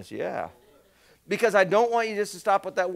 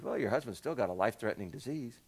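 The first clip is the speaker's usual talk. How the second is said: 1 blunt doctor; 6 semitones lower, 7 dB quieter than usual. Retold or imitated. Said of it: "You don't have to say it like that".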